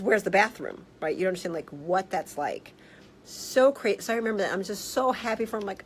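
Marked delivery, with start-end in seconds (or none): none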